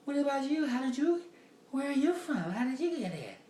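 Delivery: high, loud put-on woman's voice; woman asking questions. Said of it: "so she was like".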